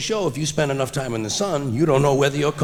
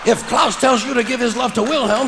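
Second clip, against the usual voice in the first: ordinary delivery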